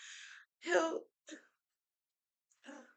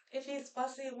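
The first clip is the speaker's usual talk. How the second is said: ordinary delivery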